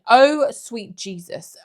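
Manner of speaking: slow, loud exasperation; exasperated. Real, not acted. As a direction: drag it out in disbelief. Exasperated at replies.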